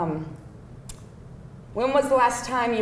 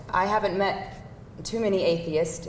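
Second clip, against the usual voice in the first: usual speaking voice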